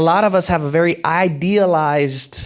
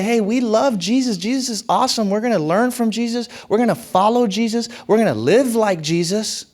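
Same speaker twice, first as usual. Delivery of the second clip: higher, bright enthusiastic proclaiming; upbeat creed; 7 semitones higher than usual. Retold or imitated. Pastor voicing the church's creed, higher and enthusiastic. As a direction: sell it like a cheerful pledge. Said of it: "is that we say".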